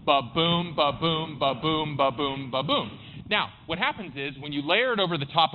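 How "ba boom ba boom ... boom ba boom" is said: steady "Ba-boom" pulses, weak beat then strong; drumbeat iambic rhythm. Shown as a demonstration. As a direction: lead a group chant of "Ba-boom", stressing "boom". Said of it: "So do it with me".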